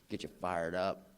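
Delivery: ordinary delivery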